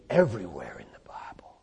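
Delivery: hushed whisper, leaning in. Real, not acted. Preacher whispers the key point to the congregation. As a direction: let the congregation in on a secret.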